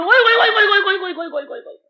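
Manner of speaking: high, rapid string of "oy oy oy", fading; delighted exclamation. Acted. Preacher marvelling at Radha's form, delighted exclamation. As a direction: start high and trail away in delight.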